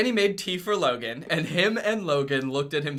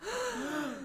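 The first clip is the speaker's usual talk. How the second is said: gasp; 12 dB quieter than usual. Acted. Reading fan fiction aloud, gasp.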